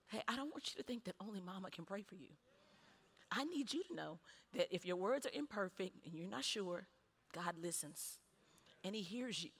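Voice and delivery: hushed, acted motherly comfort; gentle reassurance. Mother reassuring her worried son.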